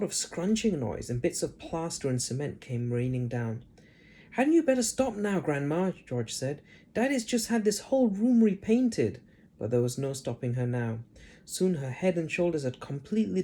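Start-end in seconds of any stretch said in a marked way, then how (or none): 4.3-5.9 worried plea. Acted. George worried pleading. 6.9-9.2 put-on worried boy. George worried pleading.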